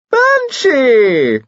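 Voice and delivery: loud, very high delighted squeal; cry of delight. A narrator's reading. Wife greeting an old friend at the door, delighted cry. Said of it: "cried Lady Carados in delight".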